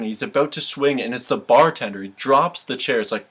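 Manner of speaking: ordinary talk, nothing marked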